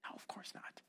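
hushed conspiratorial aside; whispered aside. Acted. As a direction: drop to a whisper, just between us. Aside answering his own question.

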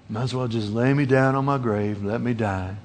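low, soft, fading to almost nothing; weary giving up. Acted. Preacher voices a sloth-sick heart, softly giving up. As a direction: sink into the grave with it.

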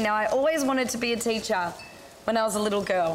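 normal talking voice

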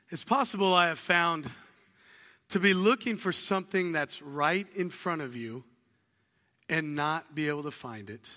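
normal talking voice

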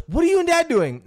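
put-on high, loud little boy's question; kid's voice. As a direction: ask like a curious kid. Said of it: "he goes".

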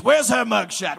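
ordinary delivery

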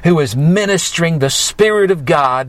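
usual speaking voice

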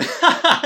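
high, loud burst of laughter. Real, not acted. Host bursting out laughing at the tantrum scene, loud and high. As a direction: crack up out loud, high and sudden.

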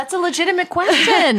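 high, put-on voice backing the kid up; mock-serious. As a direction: pretend to take it dead seriously. Mock backing the child's question.

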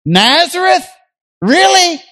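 high, scoffing disbelief; mock incredulity at Nazareth; incredulous sneer. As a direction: scoff at the very idea. Mocking Nathanael's scorn of Nazareth.

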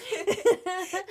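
bright burst of laughter; high laugh. Real, not acted. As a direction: laugh freely.

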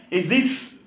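ordinary talk, nothing marked

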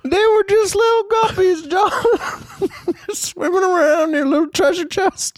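high, laughing, put-upon host scolding a guest; disgruntled host voice. Acted. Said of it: "That's my disgruntled fucking host voice".

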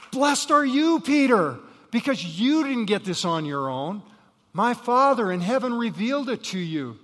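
loud, high proclaiming voice; booming praise. Acted. Jesus praising Peter before the disciples, loud and high. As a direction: ring it out like a blessing.

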